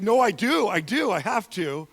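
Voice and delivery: hammed-up, emphatic arguing back; loud insisting. Author insists he must stone the adulterer, loud insisting. Acted argument in retold story.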